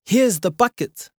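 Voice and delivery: excited cry. Acted. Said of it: "cried Sione".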